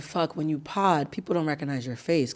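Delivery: retold aside to herself, under her voice; muttered inner thought. Podcaster thinking to herself on being recognized, muttered.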